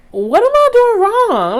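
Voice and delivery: high, loud baffled cry; confused outcry. Retold or imitated. She cries out to the glaring mother, high and baffled. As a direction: plead your innocence, bewildered. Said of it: "And I'm just like".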